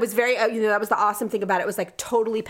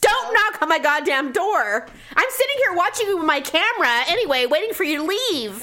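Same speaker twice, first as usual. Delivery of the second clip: raised-pitch comic scolding; mock angry rant; 5 semitones higher than usual. Annoyed customer watching a driver on camera, mock scolding. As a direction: tell them off with exaggerated exasperation.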